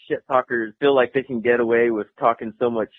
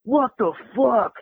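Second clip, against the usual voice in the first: gasping outrage; 9 semitones higher, slower than usual. Retold or imitated. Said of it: "And he's like".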